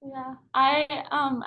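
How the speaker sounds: ordinary delivery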